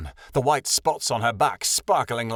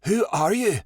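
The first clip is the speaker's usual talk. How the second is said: quivering voice; 8 semitones higher than usual. A narrator's reading. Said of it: "With a quivering voice".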